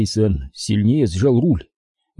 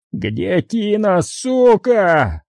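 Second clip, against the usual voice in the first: raging interrogation shout; furious yell; 9 semitones higher, much slower than usual. Acted. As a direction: scream the demand in her face. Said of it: "заорал он".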